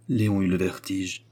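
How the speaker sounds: ordinary delivery, nothing marked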